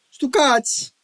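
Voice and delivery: Stu cats! cried high and loud; high Italian exclamation. Retold or imitated. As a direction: yell it like a thick Italian. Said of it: "everybody started, uh, saying".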